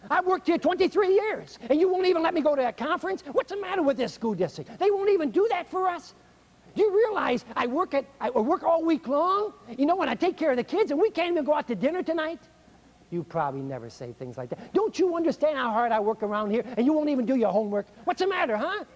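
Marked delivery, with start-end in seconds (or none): 0.1-12.4 high, loud aggrieved whining complaint; victim martyr rant. Retold or imitated. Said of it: "It's called victim martyr". 14.7-18.8 martyr parent scolding. Retold or imitated. Parent scolding kid in retold scene.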